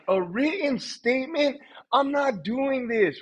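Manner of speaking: high, slow mock outrage; retold appalled protest; indignant disbelief. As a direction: stretch out the incredulous echo, then refuse. Acting outraged reply to boss.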